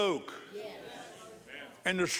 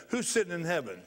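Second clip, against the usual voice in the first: normal talking voice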